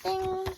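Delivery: high sung ding chime; high bell-like ding. Acted. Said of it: "page-turn signal".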